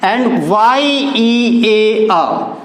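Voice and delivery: both words spelled very slowly, letter by letter; teacher dictating two spellings side by side. Shown as a demonstration. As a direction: spell them out for the class, unhurried. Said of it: "इसका pronunciation".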